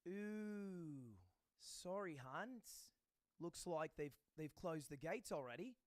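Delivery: drawn-out apologetic flight attendant act; put-on sympathetic airline staff voice. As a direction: imitate a flight attendant breaking bad news. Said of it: "like flight attendants do".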